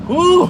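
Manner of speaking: high loud whoosh of relief; loud whew. Real, not acted. Streamer fanning herself, loud whew of heat. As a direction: blow it out, overheated. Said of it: "It is hot".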